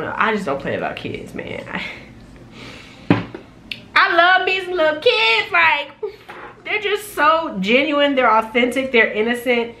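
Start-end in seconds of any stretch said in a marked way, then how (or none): 4.0-9.7 gushing fondness. Real, not acted. Speaker gushing to viewers about little kids, high fond gush.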